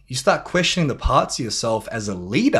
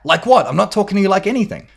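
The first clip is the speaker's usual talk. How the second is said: high, loud, rapid retort; defending back; 8 semitones higher, 5 dB louder, faster than usual. Retold or imitated. Husband defends back at his wife, acted irritation. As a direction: fire the denial straight back, indignant. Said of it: "now we defend back".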